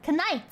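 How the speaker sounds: "Knight" with a hard K before the N; sounded K. Shown as a demonstration. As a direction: say KNIGHT as kuh-NIGHT. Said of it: "The K in night originally wasn't silent".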